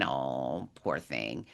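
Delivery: sincere sympathy, gently; genuine pity. Shown as a demonstration. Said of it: "that's a genuine".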